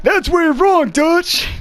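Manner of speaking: high, strained mock-heroic retort; hammed-up game-hero impression; John Marston impression. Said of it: "his voice is fun to make fun of".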